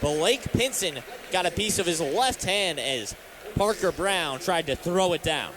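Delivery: usual speaking voice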